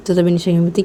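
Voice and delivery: ordinary delivery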